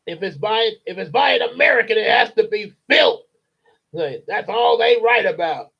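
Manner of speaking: father ranting about American writers; angry ranting father. Acted. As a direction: rant it out in contempt. Voicing Mister Wormwood.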